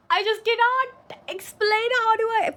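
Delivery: high, slow excited squeal; squealing with excitement; overexcited squeal. Real, not acted. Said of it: "I need to calm down".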